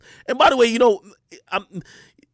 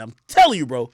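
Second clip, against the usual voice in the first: ordinary talk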